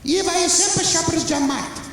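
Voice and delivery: put-on high Portuguese-accented mother voice; mother insisting in a high accented voice; mother explaining where she shops, high and accented. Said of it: "mother answers".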